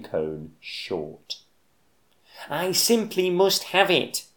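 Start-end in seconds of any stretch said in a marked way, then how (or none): 2.5-4.3 high, longing squirrel plea; wistful cry. Acted. Bruce the squirrel longing for the last pine cone, wistful high cry. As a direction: yearn for the pine cone aloud. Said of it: "he wistfully cried".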